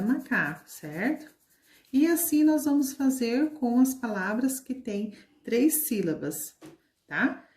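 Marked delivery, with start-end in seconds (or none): none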